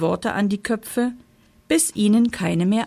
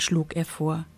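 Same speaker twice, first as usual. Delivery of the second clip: ordinary delivery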